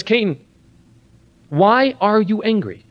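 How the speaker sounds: high, slow, searching voice of God; gentle question as God. Acted. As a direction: ask it like a patient father. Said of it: "He says".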